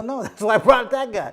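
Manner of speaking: amused reply, laughing on the words; laughing answer. Real, not acted. Laughing answer to the contract question.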